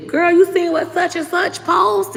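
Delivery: put-on high, slow gossipy girlfriend voice; gossiping sister.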